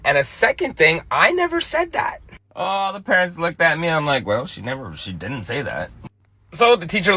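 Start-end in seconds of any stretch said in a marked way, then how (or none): 1.1-2.2 jumps into a high, squeaky pitch; imitated high-pitched little protest; child's denial. Daughter's denial, acted by father. 4.2-5.9 low, quiet, embarrassed excuse; retold awkward low mumble; sheepish backtracking. Father covering before staring parents.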